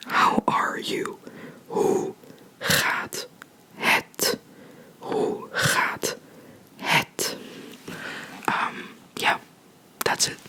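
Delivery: ordinary delivery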